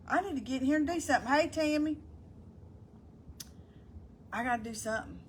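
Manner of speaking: ordinary talk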